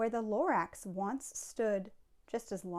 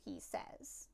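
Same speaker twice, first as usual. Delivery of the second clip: ordinary delivery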